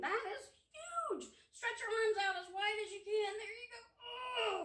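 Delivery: high, wide-eyed amazement; amazed. Pretend. Librarian marvels at the huge bone for the kids.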